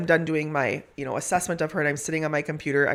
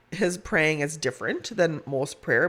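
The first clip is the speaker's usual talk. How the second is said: ordinary talk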